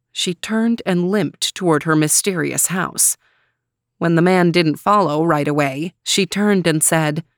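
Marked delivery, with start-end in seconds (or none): none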